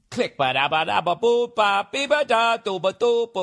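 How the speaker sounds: vocal riff imitation; scatted guitar riff. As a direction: scat the riff with your mouth.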